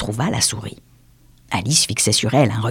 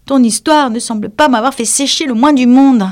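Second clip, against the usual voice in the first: glum Alice; 12 semitones higher, 6 dB louder than usual. Acted. Alice answers mouse.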